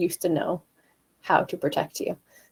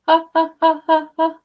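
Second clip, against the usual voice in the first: chest voice ha; 9 semitones higher, 8 dB louder than usual. Shown as a demonstration. Coach showing chest voice staccato. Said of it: "On my chest voice".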